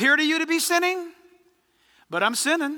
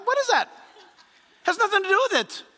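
incredulous; 8 semitones higher, faster than usual. Real, not acted. Preacher mocking excuse, pitch jumps.

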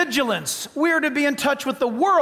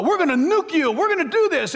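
mock bluster; 5 semitones higher, 3 dB louder than usual. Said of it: "we'll go".

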